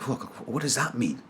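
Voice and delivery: hushed bewilderment; puzzled. Retold or imitated. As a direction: think it aloud, baffled and quiet. Retelling his puzzled whispered reaction.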